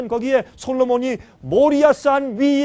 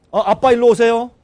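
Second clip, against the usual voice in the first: doctor calling; 9 dB louder than usual. Retold or imitated. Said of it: "그 의사, 의사가".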